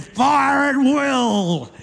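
hammed-up shout of a daredevil drunk; commanding. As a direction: drag it out like a mock drill sergeant. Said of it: "Mick commanded".

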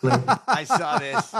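words broken up by laughter; laughing. Real, not acted. Cohost reacts to the president's clip, laughing. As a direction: laugh right through the words, drawn out.

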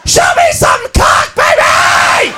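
harsh high scream, words drawn out; wild club screaming. Acted. Girlfriend at club to male stripper, wild screaming. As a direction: scream it at the stage. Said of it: "She's going".

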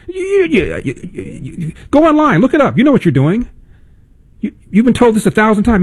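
high, whiny stammer of a flustered heckler; mocking heckler. Host mocking the heckler who can't say why, high stammering whine. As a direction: squeak out the heckler's dodge.

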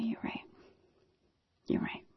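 defeated whisper; wife conceding in a soft whisper. Retold or imitated. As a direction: give in, barely voiced. Giving in, whispering to Todd.

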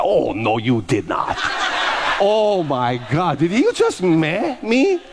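mock offended. Pastor acting out his reaction to an eye roll, sassy and slow, building high. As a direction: play the diva who just got snubbed.

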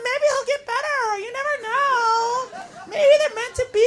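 put-on naive hopeful girl.

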